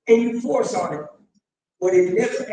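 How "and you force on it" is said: ordinary talk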